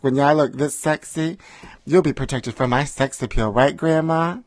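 ordinary talk

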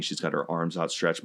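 ordinary delivery

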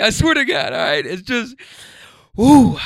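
high voice, tearful and earnest; pushing through tears; still choked up. Real, not acted. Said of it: "I'm getting emotional".

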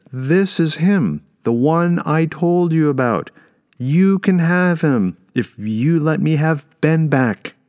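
high, loud, drawn-out declaration; calling out. A narrator's reading. Mother offering the dragon a trade, calling out loudly. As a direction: project slowly up to a huge listener. Woman bargains aloud with giant dragon.

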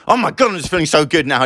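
high-pitched burst of post-run elation; buzzing, high-voiced mock exclamation; elated rush. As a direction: gush it high, riding the rush. Voicing the thrill after a run.

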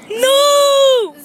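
long, high, loud "no"; drawn-out protesting yell; loud cry of refusal. Pretend. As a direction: shout it out and stretch it. Refusing to hand over taser.

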